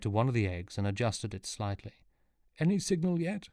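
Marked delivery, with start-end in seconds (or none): 2.6-3.5 high, hopeful character voice. A narrator's reading. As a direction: ask with hope. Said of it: "he asked hopefully".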